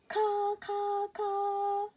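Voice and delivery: very high, loud caws; crow caws. Acted. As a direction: caw like a proud crow. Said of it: "sang Baby Crow again".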